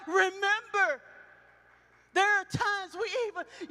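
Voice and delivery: loud, high, ringing preacher's cry; shouting sermon voice near tears; full-force preaching. Acted. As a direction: preach it out to the whole church.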